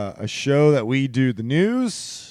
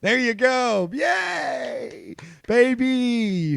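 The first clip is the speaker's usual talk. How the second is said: mock cheer; 11 semitones higher, much slower than usual. Cheering the baby news.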